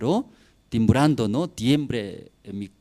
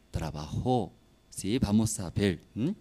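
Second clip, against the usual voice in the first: ordinary talk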